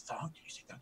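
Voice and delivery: whispered, no voice at all; murmuring orcs. Acted. Orcs in the back whisper about the stranger's name. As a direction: murmur it among yourselves. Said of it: "so they're like".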